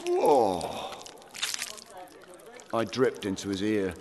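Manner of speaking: plain reading voice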